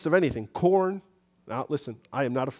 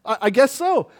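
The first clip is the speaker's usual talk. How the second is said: higher, nervous laughing reply; 10 semitones higher, 5 dB louder than usual. Retold or imitated. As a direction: caught off guard, laugh it off nervously. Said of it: "And I'd be like".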